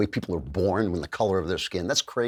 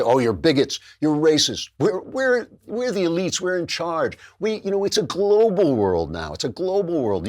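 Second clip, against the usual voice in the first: higher-pitched mock scolding of the public; mock elite officials; 6 semitones higher than usual. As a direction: imitate dismissive elites, pitched up. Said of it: "the government doesn't listen".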